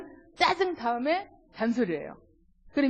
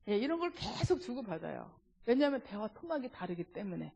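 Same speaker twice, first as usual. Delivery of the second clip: usual speaking voice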